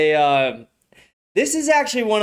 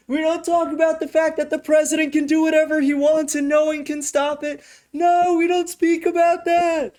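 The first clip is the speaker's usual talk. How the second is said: a frantic handler squeaking commands off camera; mock camera handler; 12 semitones higher than usual. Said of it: "It was like".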